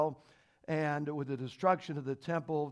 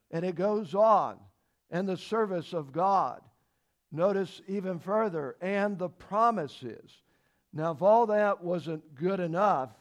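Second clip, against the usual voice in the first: stack each point louder than the last; building preaching; 3 semitones higher, 4 dB louder than usual. Acted. Preacher building fervor through the list of Israel's gifts.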